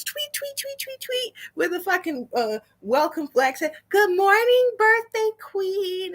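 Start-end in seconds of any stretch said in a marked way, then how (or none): 0.0-1.3 high, soft chirping tweets; imitated birdsong, high and light; bird tweets. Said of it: "there should have been birds". 3.9-6.1 high, drawn-out celebratory greeting; high-pitched welcome announcement; mock birthday fanfare.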